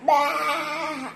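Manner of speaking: bleating baa; sheep baa. Acted. Sheep bleating a song to the dog, bleat. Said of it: "and sheep went".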